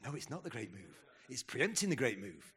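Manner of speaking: voice of the Lord. Retold or imitated. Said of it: "the Lord's like".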